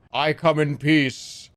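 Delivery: slow, solemn alien-visitor voice; alien greeting. Acted. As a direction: say it like a movie alien landing. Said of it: "a fucking alien".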